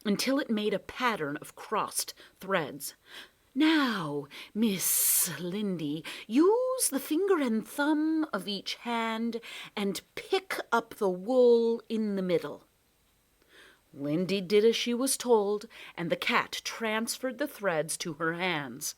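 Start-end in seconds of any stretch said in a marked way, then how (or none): 3.5-12.7 higher, slow coaxing cat voice; Splinter Cat voice. Acted. Sly cat teaching Lindy a string game, coaxing. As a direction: purr the instructions like a sly cat.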